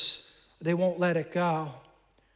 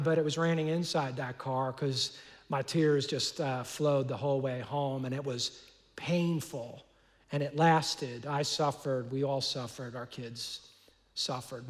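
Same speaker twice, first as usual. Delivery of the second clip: low, soft, grief-heavy telling; sorrowful; 5 semitones lower, 5 dB quieter than usual. Real, not acted. Pastor telling the church of his family's grief, softly sorrowful. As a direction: let the voice sink under the memory.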